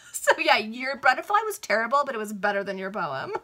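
real giggling comment; laughing aside. Real, not acted. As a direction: tease through laughter.

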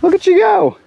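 squeaky excited encouragement; high-pitched praise. Real, not acted. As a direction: cheer her on in a high squeal. Cheering on the fire lighting.

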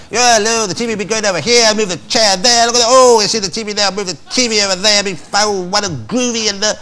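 breezy mock-Eddie rambling while shifting furniture; impression of Eddie. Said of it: "That's a good impersonation of it".

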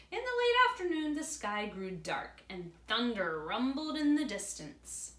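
put-on reading aloud to children; story narration. A narrator's reading.

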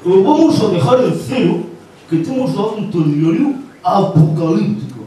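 melodramatic anchor voice; Pedro Piqueras. Acted. Newsman passenger haggles over the fare, dramatic Piqueras impression. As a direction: proclaim it like doom-laden news. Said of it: "Me para el taxi Pedro Piqueras".

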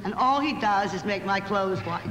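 usual speaking voice

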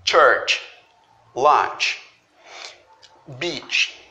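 church, lunch and beach with a TCH sound; English CH. Shown as a demonstration. Teacher repeats the CH examples for students. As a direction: snap the tch. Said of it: "esse som de ch".

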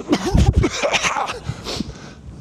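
high, breathy mock cough, imitating a caught teen; teen faking a cough to hide her vape; fake cough. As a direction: cough it off like you've been caught vaping. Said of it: "and she's".

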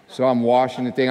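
normal talking voice

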